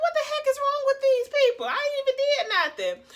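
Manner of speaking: high, loud exasperated yell; road rage. Retold or imitated. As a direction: vent at the honking drivers. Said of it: "I got road rage".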